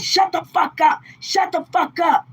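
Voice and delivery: high, shouted mock rage; imitating boyfriend's furious shout. Retelling the sitter yelling at her baby, furious shout. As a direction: yell it like the angry sitter.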